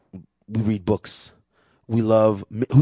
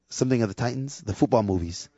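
usual speaking voice

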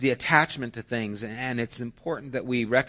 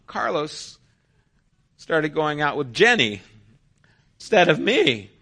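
higher, louder hurt complaint; upset daughter; 5 semitones higher, 7 dB louder than usual. Retold or imitated. Daughter tells parent she was dumped, hurt and mad. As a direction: whine it out as a jilted teen.

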